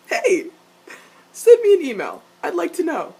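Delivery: high, loud call-out; eager demand. Acted. Fan asking the character to email her, loud eager call. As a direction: call out playfully, pushing for details.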